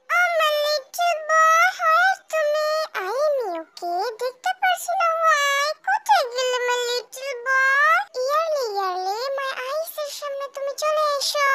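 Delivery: drawn-out gushing mother; high, loud, very slow doting mom voice. Acted. Mother calling to her son, gushing and drawn out. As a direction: coo over her little boy.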